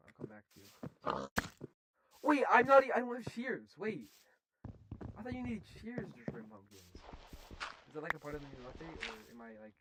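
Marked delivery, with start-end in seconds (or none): 2.2-8.6 high, loud puzzlement; confused surprise. Real, not acted. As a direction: blurt out baffled. Confused by game mechanic.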